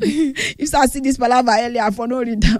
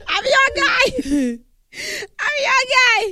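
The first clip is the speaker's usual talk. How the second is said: high, laughing squeal; gleeful high-pitched insistence; excited declaration; 11 semitones higher than usual. Acted. Repeats claim between laughs.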